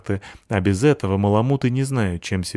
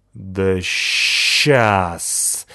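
hissed. Acted. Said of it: "зашипел сверху Рыжопиус".

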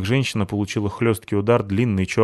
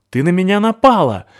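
high, loud accusing cry; indignant shout; 10 semitones higher, 8 dB louder than usual. Acted. Абута кричит на Натаху, high loud indignant shout. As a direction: yell it back at her. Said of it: "крикнула Абута".

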